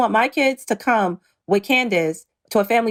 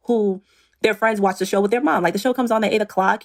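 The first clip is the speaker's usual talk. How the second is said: usual speaking voice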